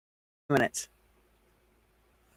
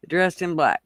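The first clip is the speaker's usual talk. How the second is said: ordinary talk, nothing marked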